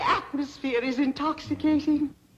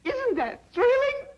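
thrilled; 11 semitones higher than usual. Acted. Excited at the princess.

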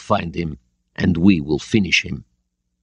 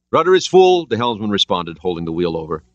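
ordinary delivery

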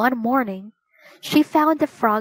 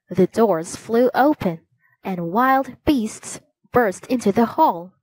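ordinary delivery

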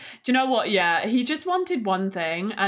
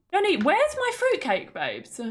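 high, sing-song demand for fruitcake; playfully indignant sim voice; mock demanding; 9 semitones higher than usual. Player voicing her sim demanding fruitcake from Johnny Zest, mock indignant.

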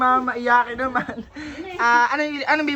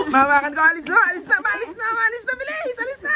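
frantic urging; 9 semitones higher than usual. Real, not acted. Vlogger holds child, tells mama to leave, rising frantic urging. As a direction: voice climbing higher and higher as it repeats.

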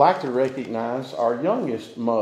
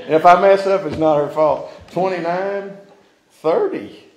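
ordinary delivery

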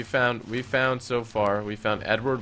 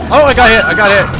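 loud pained cry; hit hard crying out; 11 semitones higher, 16 dB louder than usual. Real, not acted. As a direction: cry out as if struck. Player struck by monster.

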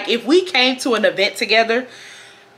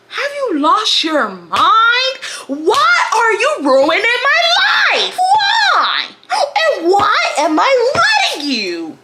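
high, drawn-out wailing outburst; hammed-up exasperation; 11 semitones higher, much slower than usual. Venting at the man ruining her life, exasperated wail. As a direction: wail each question slowly in mock despair. Acted outburst at Stanley's pestering.

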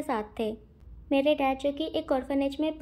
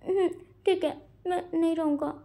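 tearful; 4 semitones higher than usual. A narrator's reading. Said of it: "खुद के आंसू पोंछने लगता है".

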